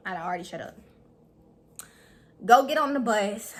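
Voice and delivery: ordinary delivery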